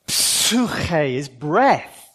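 Psuche is breath said very slowly; exaggerated slow. Shown as a demonstration. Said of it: "Slowly, exaggerate".

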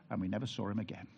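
ordinary talk, nothing marked

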